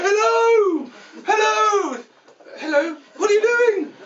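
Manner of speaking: very high, loud drawn-out hail; calling out. Acted. Greeting someone with bags in the street, calling out. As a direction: holler as if across the sea.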